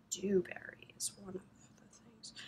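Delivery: hushed whisper to the yarn; soft unvoiced whisper. Real, not acted. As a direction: breathe it like a secret.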